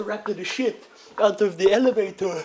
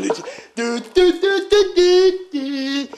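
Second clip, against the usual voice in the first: rising sung do do do, much higher and louder; hurdle jump sound; 9 semitones higher, 5 dB louder, much slower than usual. Retold or imitated. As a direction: bounce up the notes like leaping hurdles. Jumping onto the table.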